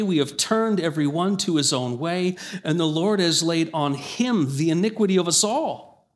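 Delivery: preacher reading Isaiah aloud to the church, lifted and solemn; scripture reading. Acted. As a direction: proclaim the verses to the congregation.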